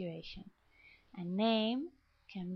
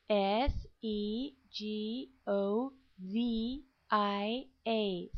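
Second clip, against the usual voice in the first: letters spelled slowly one by one; slow spelling; much slower than usual. Shown as a demonstration. Teacher spelling a name for the class, slow.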